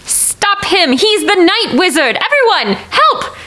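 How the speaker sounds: high-pitched appeal to a crowd; urgent call for help. Acted. Deputy appeals to the crowd to stop him, urgent and high.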